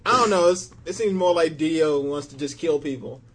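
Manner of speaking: usual speaking voice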